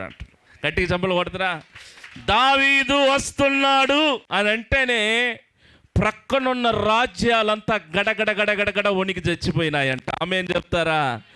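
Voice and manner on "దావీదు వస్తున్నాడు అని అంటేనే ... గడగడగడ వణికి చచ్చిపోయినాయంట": booming pulpit announcement; thundering proclamation. Acted. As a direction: announce దావీదు వస్తున్నాడు like a battle cry that shakes kingdoms. Preacher proclaims David's dreaded name.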